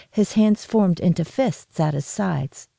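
ordinary delivery

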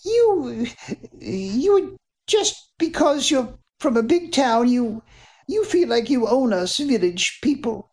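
high voice stumbling over you, you; trembling but standing her ground; young woman forcing out a stammered protest; shaken stammering defiance. Acted. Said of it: "in an attempt to stop them from shaking".

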